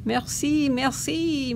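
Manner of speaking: a grateful, mildly breathless merci; happy panting. A narrator's reading. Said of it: "Missus Poodle said, panting happily".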